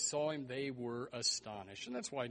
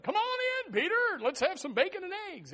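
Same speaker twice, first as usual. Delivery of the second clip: high, loud, delighted call; acted excited welcome; 10 dB louder than usual. Girl at the gate welcoming Peter, excited loud call. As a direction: call him in at full volume, high-pitched.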